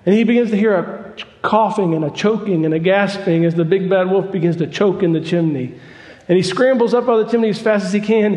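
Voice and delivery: rising, fervent preacher building the story; preaching at full force. Acted. Preacher to congregation, building fervent excitement. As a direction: drive the tale up with mounting fire.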